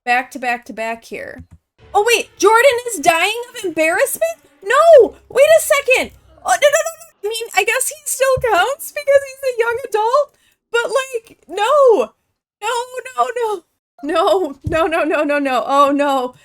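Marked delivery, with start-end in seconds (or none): 1.9-12.1 loud, high-pitched disbelief; shocked outburst. Real, not acted. Shock at sudden death in game. 12.6-16.3 repeated no's, loud and urgent; dismayed panic. Real, not acted. Dismay as game character dies.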